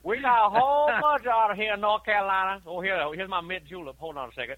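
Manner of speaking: put-on a deliberately terrible North Carolina accent, booming then settling; bad North Carolina accent. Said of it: "That's the worst North Carolina accent".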